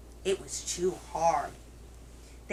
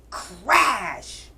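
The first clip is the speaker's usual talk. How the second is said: loud, drawn-out storybook crash; dramatic crash; 7 dB louder than usual. A narrator's reading. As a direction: draw the word out with a bang. Acted sound of breaking chair.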